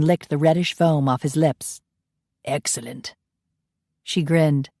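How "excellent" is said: quiet low Scot. Acted. Ian praising the Bleer, low and soft. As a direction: lower and much softer, savouring.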